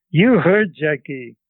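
put-on grandmother's Central European accent. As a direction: admonish the boy in a thick Central European accent. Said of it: "imitate my grandmother, uh, my grandmother's, uh, English".

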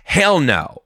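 emphatic refusal. Retold or imitated. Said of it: "I was also like".

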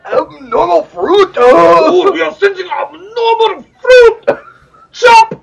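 hammed-up anime shout. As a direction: yell it like a cartoon hero sensing danger. Hamming up the headline in anime style.

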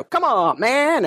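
lightly voiced Biden, pitch rising on man; a hint of Biden's folksy come on man; Biden impression. Quoting Biden in the Charlemagne interview, light impression. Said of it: "Joe Biden talking to Charlemagne".

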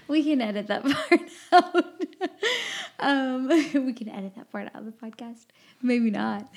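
talking through real giggles; laughing. Real, not acted. Host joking to co-host about cutting her tangent, laughing. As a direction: laugh while getting the words out. Laughing over own rabbit trail.

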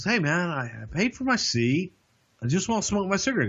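high, fast protesting smoker; whiny smoker. Retold or imitated. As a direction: whine that you paid for the seat.

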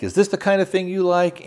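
a hint of a stern Russian wife confronting him; accusing wife. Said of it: "And she was like".

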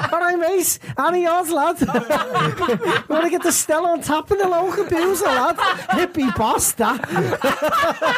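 high, laughing Scouser voice; mock Scouse. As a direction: put on a Liverpool lad through laughter. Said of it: "a lot of Scousers from Green Hills outside going".

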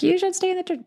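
prim mocking church-lady voice; mock churchgoer. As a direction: squeak it out like a prim ward member. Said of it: "if you're L D S and be like".

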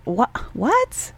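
baffled retold "what?", rising high at the end; baffled disbelief. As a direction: act out being dumbfounded, pitch leaping up. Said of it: "where I was just like".

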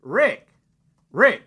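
high calling out across a distance; hollering. Retold or imitated. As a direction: call his name loud and high. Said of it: "I'm out there hollering to you".

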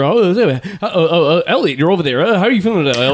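mock fast-forward host; sped-up talk. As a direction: race through the question like fast playback. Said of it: "I wanna talk that speed now".

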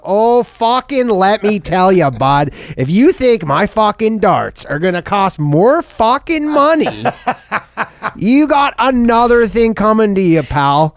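a riled-up Canuck defending his smokes; a mock Canadian accent fuming over the cigarette tax; super Canadian. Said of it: "he goes super Canadian".

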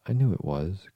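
agitated storybook cry. A narrator's reading. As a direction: burst out in dismay. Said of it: "cried Tweedledum".

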